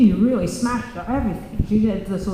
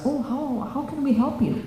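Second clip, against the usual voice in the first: politely asking a visitor; a mildly polite stepmother at the door; 6 semitones higher than usual. Retold or imitated. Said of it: "said politely".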